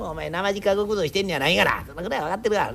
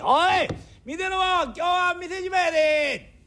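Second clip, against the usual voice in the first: calling out; 10 semitones higher than usual. Acted. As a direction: shout to the whole staff to close up. Young master calls out to staff.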